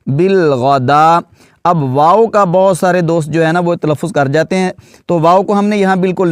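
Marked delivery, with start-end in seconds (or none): 0.1-1.2 بل غدا drawn out slowly with long a; slow careful بل غدا; slow syllable demo. Shown as a demonstration. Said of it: "آگے دال پر کھڑا زبر ہے".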